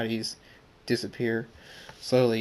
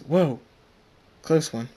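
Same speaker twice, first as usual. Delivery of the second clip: higher, startled exclamation; 5 semitones higher, 4 dB louder than usual. Acted. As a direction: jump back with a louder, raised yelp of words. Character startled by lightning strike.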